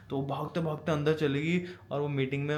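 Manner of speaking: ordinary talk, nothing marked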